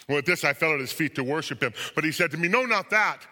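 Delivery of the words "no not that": high, loud objection; sudden raised protest; urgent rebuke. A narrator's reading. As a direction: stop them short with a sharp cry. Angel stops him from worshipping.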